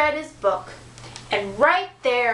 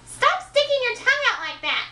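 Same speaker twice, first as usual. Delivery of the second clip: high, nagging princess voice; 10 semitones higher, faster than usual. Acted. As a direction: nag at him in a high voice. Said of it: "nagged the princess".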